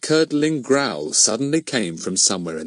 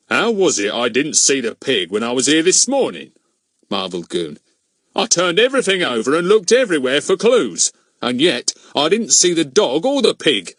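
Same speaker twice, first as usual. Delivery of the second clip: louder policeman voice, baffled; a bobby marvelling at missed clues; puzzled policeman; 5 semitones higher, 5 dB louder than usual. Acted. Mister Goon puzzling over the missing pig, louder baffled policeman voice.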